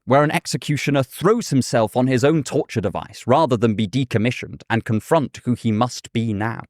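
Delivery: usual speaking voice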